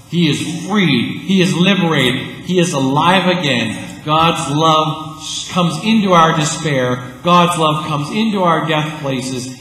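loud, slow sermon cadence; preaching at full force. Acted. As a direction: lift the congregation with each line.

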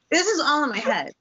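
laughing while retelling her thoughts. Real, not acted. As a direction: laugh through the words. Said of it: "I didn't say it".

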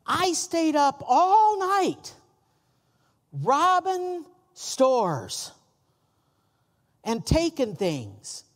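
slow, high, drawn-out mock brag; mock boastful criminal. Preacher imitating criminals who never brag of stores robbed, mock slow brag. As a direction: lightly ham up a crook bragging about his night. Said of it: "never once did any of them say".

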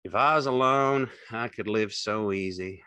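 monotonous, no emphasis; wooden. A narrator's reading. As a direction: recite it woodenly. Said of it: "He said woodenly".

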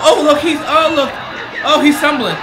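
high, loud, pointing at the screen; excited calling out. Real, not acted. To viewers about a stumbling fighter, excited calls. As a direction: call out the stumble.

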